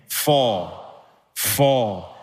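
FOR low, drawn out and falling; stress on FOR. Shown as a demonstration. Pastor drilling congregation on the key word, stress on FOR. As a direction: land firmly on each separate for. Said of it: "Emphasizing each of these four words".

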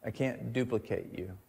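soft, hushed voice of God; the Lord speaking. Retold or imitated. God telling the young sinner, hushed and soft. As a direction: let it fall quietly and gently. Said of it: "he's like".

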